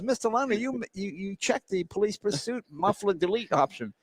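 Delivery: lightly voiced salesman breaking bad news; sheepish salesman. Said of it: "The guy goes".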